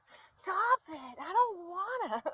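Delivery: put-on high whiny child voice; whiny kid voice for the pet. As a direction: whine like a stubborn little kid. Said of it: "She's like".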